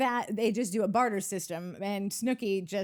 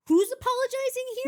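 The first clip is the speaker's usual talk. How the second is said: bewildered; 5 dB louder than usual. Retold or imitated. Acid-trip confusion quote.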